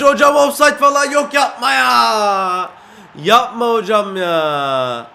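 loud wail with drawn-out endings; protesting shout. Real, not acted. Player disputes offside with referee, loud drawn-out protest. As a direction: yell in dismay at the referee.

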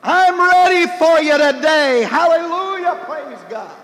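high, loud triumphant shout; shouting at the world. Retold or imitated. Son reads advice to shout at morning, a loud exultant shout. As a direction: shout it like a wake-up battle cry. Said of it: "shout at the world".

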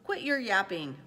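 loud, dismissive eagle retort; brusque storybook call to a pursuer; calling back. A narrator's reading. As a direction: toss it back over your shoulder, loudly. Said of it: "over his shoulder called back".

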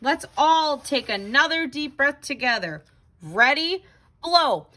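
ordinary delivery, nothing marked

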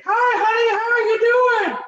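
very high squeaky mock voice; hammed-up falsetto greeting to his wife; Minnie Mouse squeak. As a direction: squeak it like a cartoon mouse after a groin hit. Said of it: "I sound like Minnie Mouse".

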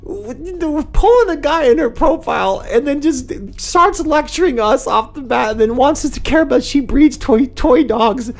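high-pitched, incredulous ranting; exasperated rant. Real, not acted. Exasperated rant after laughing at profile.

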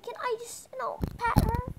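high, excited, tentative request; eager pleading. Pretend. Shy pony asking to pat the baby dragon, eager. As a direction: ask as if barely able to wait.

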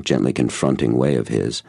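plain reading voice

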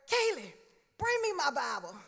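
high, loud call across the house. Retold or imitated. Dad on the porch calls his daughter for his Bible. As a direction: shout for her to fetch it.

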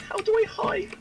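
panicked high squeal; scared horror game streamer. Real, not acted. Panicked corrected question, much higher.

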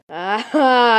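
ordinary talk, nothing marked

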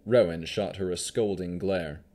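ordinary delivery, nothing marked

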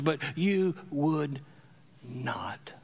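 fading to an unvoiced whisper; lamenting whisper. Jesus grieving over the city, whispered. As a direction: let the line die into a whisper.

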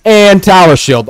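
loud, high item-by-item readout; incredulous shouting. Real, not acted. Gamer reading out a teammate's items, incredulous shouting. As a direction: call out each item in loud disbelief.

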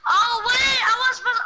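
loud, high burst of alarm; sudden realization. Real, not acted. Player catching own slip with friend, startled realization.

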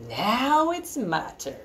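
slow, drawn-out big-goat voice. Acted. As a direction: take the turn with slow weight. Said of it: "Big Billy Goat Gruff thought".

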